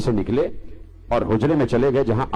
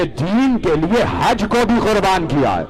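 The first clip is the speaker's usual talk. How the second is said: high, fervent declaration; impassioned climax; 6 semitones higher than usual. Real, not acted. Preacher revealing the greatest sacrifice, fervent and high. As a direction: drive the point home with a raised, ringing pitch. Climax, then repeated calmly.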